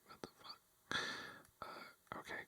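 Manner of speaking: normal talking voice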